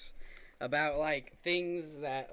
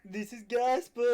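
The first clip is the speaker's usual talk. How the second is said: mock preacher; 8 semitones higher, 8 dB louder, slower than usual. Mock grand declaration.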